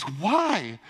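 drawn-out, swooping mock bafflement; baffled roommate. Roommate questioning his abstinence, baffled. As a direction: imitate someone utterly unable to understand.

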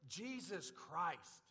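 loud, fervent preaching to the church; full-force preaching. Acted. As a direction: drive each line home to the congregation.

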